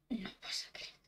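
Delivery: soft, breathless whisper; hushed disbelief; scared whisper. Real, not acted. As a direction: whisper it as if afraid to be heard. Spooked by creepy game.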